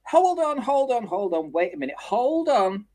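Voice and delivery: high, sharp interruptions; mock judge interrupting the witness; judge cutting in. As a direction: stop him mid-sentence. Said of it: "and she goes".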